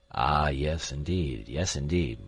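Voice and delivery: a hint of a dithering old professor; lightly voiced old professor. A narrator's reading. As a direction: hum it absently.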